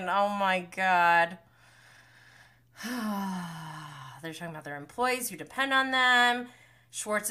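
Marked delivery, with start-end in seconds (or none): none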